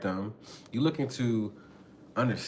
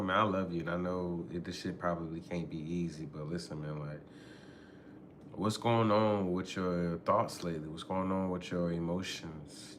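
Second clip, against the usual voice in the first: soft, lower, tender check-in with a partner; gentle reassurance; 6 semitones lower, 9 dB quieter than usual. Retold or imitated. Speaker soothing an upset partner, soft and tender. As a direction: ease them open, quiet and caring.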